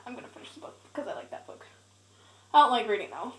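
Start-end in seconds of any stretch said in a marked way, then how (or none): 0.1-1.7 fast whispered aside; whispering. Real, not acted. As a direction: rush it out in a whisper. Quick aside to herself.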